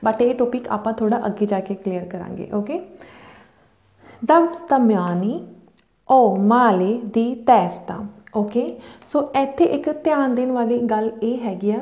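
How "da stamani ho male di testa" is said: very slow Italian with a pause after stamani; slow model reading. Shown as a demonstration. Teacher shows students how to read with pauses. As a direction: read it slowly, pausing where she pauses. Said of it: "ਤੁਸੀਂ ਧਿਆਨ ਦਿਓ ਕਿ ਮੈਂ ਕਿੱਦਾਂ ਪੜ੍ਹ ਰਹੀ ਹਾਂ".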